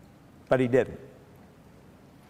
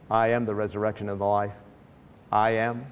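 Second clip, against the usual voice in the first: solemn calm; 6 semitones lower than usual. Retold or imitated. Jesus solemn declaration.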